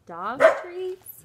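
high, loud disbelieving cry; shocked kid. Acted. David discovering dog treats, high and loud. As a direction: recoil at the taste.

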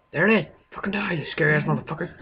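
high-pitched attack cry; excited battle shout. Real, not acted. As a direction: shout it as you spot and attack. Spots the monster and attacks it.

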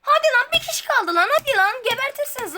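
high, loud pleading push; willing the last kill; urging on. Real, not acted. (heyecanlı gaz verme)

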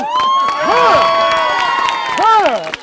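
loud high sung grunt; sung huh. Retold or imitated. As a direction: belt out a sharp huh. Sung ad-lib ending.

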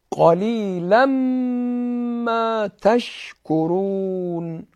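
قلیلاً ما with the نون merging into م; merged tanween recital. Shown as a demonstration. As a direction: blend قلیلاً into ما. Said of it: "ادغام". (ادغام تنوین در میم)

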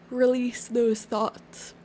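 slow, stretched yawn through the words. Real, not acted. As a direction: say it mid-yawn. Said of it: "Oh, I just yawned for some reason".

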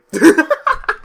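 loud rueful laugh; rueful high laugh. Real, not acted. High rueful burst of laughter. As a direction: gamer laughing off his defeat.

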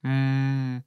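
ordinary delivery

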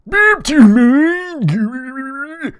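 very high, loud, slow stretched cry; character shout. Acted. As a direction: wail it in a high put-on voice. Tard snatched from by wrangler.

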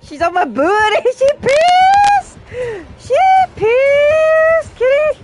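high loud laughing shriek; panicked shriek. Real, not acted. Streamer chased by the killer, loud high laughing shriek. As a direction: squeal it, half laughing, half panicking.

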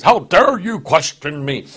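a hint of an offended, booming deity; mock angry God. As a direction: voice the God he rejects, indignant. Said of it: "God just gets really hacked off".

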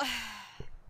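long tired exasperated sigh; heavy sigh. Real, not acted. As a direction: let out a weary sigh. Streamer frustrated at own mistake.

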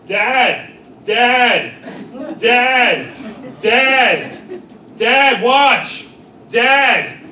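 put-on high, loud repeated yelling kid; kid yelling for dad. Comedian impersonating a kid calling his dad from the diving board, high loud yells. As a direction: shout over and over like an eager eight-year-old on a diving board. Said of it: "here's my impression of an eight-year-old kid".

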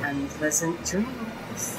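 slow, drawn-out ominous farewell; slow sign-off. Acted. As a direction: draw it out slowly and darkly.

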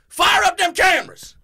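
loud high shout. Retold or imitated. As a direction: yell it across the yard. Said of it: "he goes".

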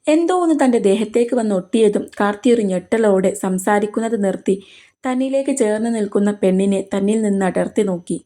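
ordinary delivery

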